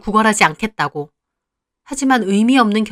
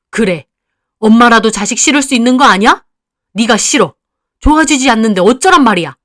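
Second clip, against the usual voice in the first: loud, cutting rejection; angry mother; 2 semitones higher, 6 dB louder than usual. Acted. Mother telling her daughter she dislikes her, loud and cutting. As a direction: tell her flatly you hate her.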